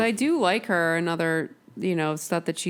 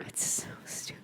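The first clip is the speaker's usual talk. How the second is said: whispering; 14 dB quieter than usual. Pretend. Teasing back and forth.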